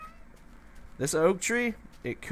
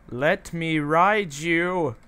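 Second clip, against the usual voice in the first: slow and louder, stretching "ride"; "Let me ride you" dragged out slowly, a bit higher and louder; drawn-out pleading; 5 semitones higher, 6 dB louder, much slower than usual. Pretend.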